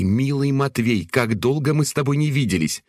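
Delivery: coquettish. A narrator's reading. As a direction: coo his name flirtatiously. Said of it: "кокетливо начала она".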